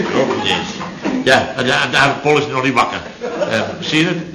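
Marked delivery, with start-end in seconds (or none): none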